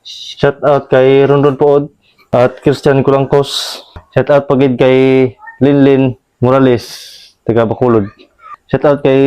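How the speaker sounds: radio shout-out. Acted. Reads shout-outs like a DJ.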